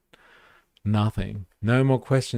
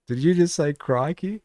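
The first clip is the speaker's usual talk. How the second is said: higher-pitched mock amazed question; lightly put-on voice of an incredulous outsider; imitated foreigner; 8 semitones higher than usual. Outsider reacting to an Australian's word, mock amazed.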